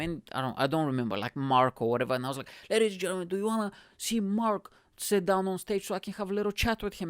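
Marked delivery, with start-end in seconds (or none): 2.7-7.1 high, projected emcee announcement; pitched-up MC voice calling to an audience; stage MC. Retold or imitated. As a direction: work the crowd like a show host. MC addressing the crowd on stage.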